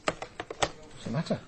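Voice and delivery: slow, barely voiced question; quiet worried enquiry; hushed concern. Real, not acted. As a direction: ask gently, almost in a breath.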